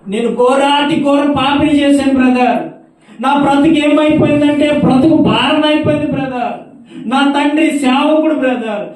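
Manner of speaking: high, loud tearful wail; crying caller. Retold or imitated. A woman confessing to the brother on the phone, loud tearful wail. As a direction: wail it like a broken sinner. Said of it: "ఎందుకు అలా ఏడుస్తున్నావు".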